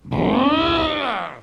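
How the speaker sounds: loud high airport buzzer imitation; buzzer noise. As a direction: buzz like a metal detector. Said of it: "this big buzzer goes".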